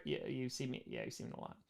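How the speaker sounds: breathy whispered admission; whispered aside. Real, not acted. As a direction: drop to a whisper, sheepish. Admitting she's seen plenty.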